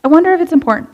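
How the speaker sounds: a mock debate over the unknown call; hesitant musing. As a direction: act unsure whether to pick up. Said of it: "you're like".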